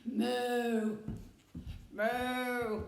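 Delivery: a drawn-out mooing cow. Shown as a demonstration. Being a cow for the children. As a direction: moo like a friendly cow. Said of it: "I've just been a cow".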